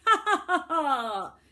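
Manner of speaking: loud, high villain's ha ha; mocking laugh. Boy villain laughs at the children, loud and high. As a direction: throw your head back and laugh at them. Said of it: "threw back his head and laughed".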